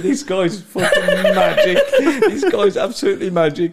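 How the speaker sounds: loud, high, laughing praise; howling with laughter. Retold or imitated. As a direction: gush through helpless laughter. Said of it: "I'm fucking howling".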